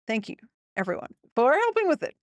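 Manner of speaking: ordinary talk, nothing marked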